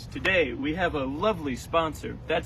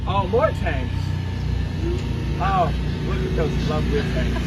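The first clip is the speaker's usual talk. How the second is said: loud, higher, gleeful; excited loud aside; 4 semitones higher, 10 dB louder than usual. Real, not acted. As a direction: cheer over the passing tanks. Excited aside about tanks, loud.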